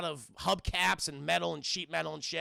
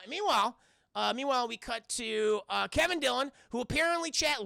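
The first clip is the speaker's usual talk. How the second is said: ordinary talk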